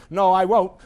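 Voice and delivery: loud acted refusal; defiant shout. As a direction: shout back stubbornly at a bully. Defiant reply while being beaten.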